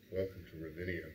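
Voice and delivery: low, soft, gentle welcome; lightly mocking soft-spoken intro; hushed announcer. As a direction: murmur it like a sleepy NPR host. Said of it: "it's more like".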